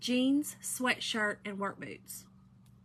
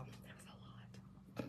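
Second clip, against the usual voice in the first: breathless whisper; whispered it was a lot; 25 dB quieter, much slower than usual. Real, not acted. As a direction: breathe it out as a private aside. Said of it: "repeated aside whispered".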